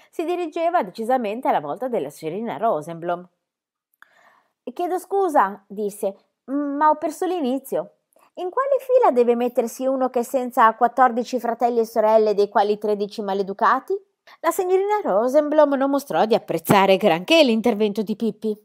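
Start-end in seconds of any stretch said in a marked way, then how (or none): 4.7-5.6 put-on cheeky girl voice. Pippi interrupts the teacher. 6.5-14.0 put-on cheeky girl voice. Pippi asks her odd question.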